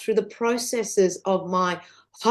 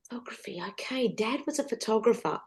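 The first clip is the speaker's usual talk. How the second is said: soft whisper, thinking aloud; whispered to herself; 9 dB quieter, faster than usual. Retold or imitated. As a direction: murmur it as private self-talk. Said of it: "I was just talking to myself".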